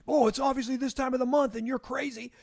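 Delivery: mock the wife as if she's being silly; dismissive mocking tone. Shown as a demonstration. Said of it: "if you're saying it like that".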